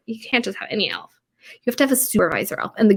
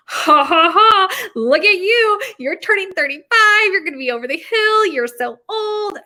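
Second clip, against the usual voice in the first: high-pitched cackling tease; mocking taunt; 9 semitones higher, 4 dB louder, slower than usual. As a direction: gloat and laugh at him for getting old. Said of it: "and I was like".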